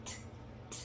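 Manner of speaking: "t, t" as voiceless tongue taps, no vowel; mute T. Shown as a demonstration. Teacher isolating the mute consonant, voiceless T. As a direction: click out "t", pause, repeat. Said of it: "que seria mudo".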